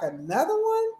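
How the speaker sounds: high, punchy shout-out; hype catchphrase. Pretend. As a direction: hype it like a DJ tag. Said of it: "You feel like".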